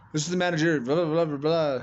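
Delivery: high, loud mocking manager voice. Mocking the hotel manager at door.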